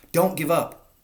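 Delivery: high breathless plea; panting. A narrator's reading. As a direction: gasp it out urgently. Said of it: "panted Mister Fox".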